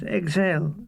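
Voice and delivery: ordinary delivery, nothing marked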